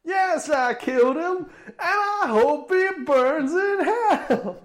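high, slow, laughing yell; Samuel L. Jackson impression. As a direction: bellow it like an angry preacher. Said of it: "a Samuel L. Jackson impression".

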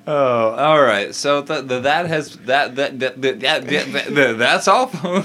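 excited stammering. Real, not acted. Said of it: "You, you need to calm down".

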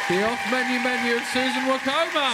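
high booming show announcer. Acted. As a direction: announce her like a game show host. Show host announcing guest.

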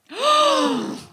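high, sharp theatrical gasp; mock gasp. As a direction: gasp as if caught out. Mock shocked reaction.

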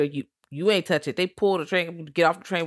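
ordinary delivery

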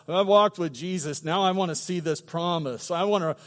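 normal talking voice

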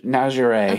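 "Najere" said nazh-er-AY; pronunciation demo. Shown as a demonstration. Answering how the name is pronounced, "Najere" as nazh-er-AY. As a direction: model "Najere" with a French zh and final ay. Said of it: "how do we pronounce the name".

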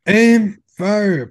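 high, loud drill call of a firing order; mock military command. Friend playing the funeral gun salute, mock military command.

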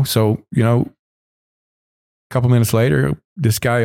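ordinary talk

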